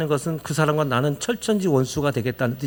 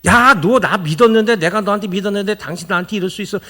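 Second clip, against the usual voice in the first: loud, higher, rushed accusation; mock-angry confrontation, louder and faster; emotional reproach; 4 semitones higher, 4 dB louder than usual. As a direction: hurl the betrayal at them. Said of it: "이런 감정적인 이야기".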